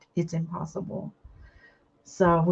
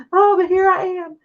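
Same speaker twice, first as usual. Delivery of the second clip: high, loud teary wail through a laugh; real tearful voice; 12 semitones higher, 9 dB louder than usual. Real, not acted. Host admitting to viewers she is crying on her live, tearful. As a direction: let tears lift the voice high. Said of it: "I usually don't cry on my lives".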